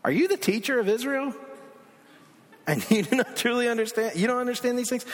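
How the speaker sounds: Jesus scolding a learned teacher, incredulous; incredulous rebuke. Acted. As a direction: challenge him, amazed he doesn't get it. I like Jesus' comeback.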